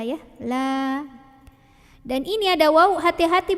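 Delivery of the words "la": "La" held just two beats. Shown as a demonstration. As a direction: sustain "La" briefly and evenly. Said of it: "dua harokat aja".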